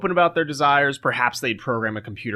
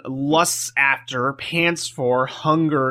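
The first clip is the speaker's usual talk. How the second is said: slow savoring; much slower than usual. Acted. Slowly savoring the lusty verbs.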